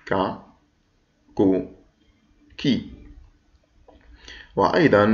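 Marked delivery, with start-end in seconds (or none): 0.1-2.9 ك with a short a, كو with a short u, كي with a short i; short vowels demo. Shown as a demonstration. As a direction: model each short vowel clearly. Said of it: "أعد معي". (نطق الكاف مع الحركات القصيرة)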